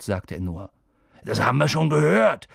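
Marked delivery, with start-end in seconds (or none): 1.2-2.5 loud, raised, peevish retort; grumpy. A narrator's reading. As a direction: cut back at them in irritation. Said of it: "sagte Hagrid verdrießlich". (verdrießlich, laut)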